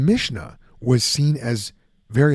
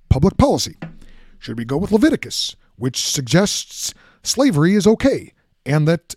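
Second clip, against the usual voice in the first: Barack Obama impression; 4 semitones higher than usual. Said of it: "a Barack Obama impression".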